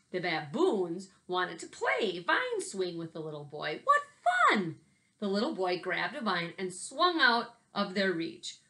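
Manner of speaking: lively picture-book read-aloud with big swings; reading to children. Acted. Teacher reading a picture book to her class, lively. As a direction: tell it like a story hour for kids.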